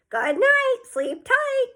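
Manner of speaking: high squeaky bird voice; parrot voice. A narrator's reading. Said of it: "came the reply".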